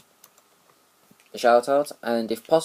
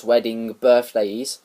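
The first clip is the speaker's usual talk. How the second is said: normal talking voice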